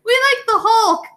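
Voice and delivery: put-on high, chirpy kid voice; high mock-child voice; imitated schoolkids. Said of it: "they're like".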